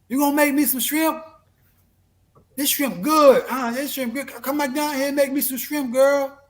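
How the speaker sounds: mock shouting for shrimp from downstairs; retold yell from downstairs. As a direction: holler for Aisha from the floor below. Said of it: "You hear someone downstairs yell".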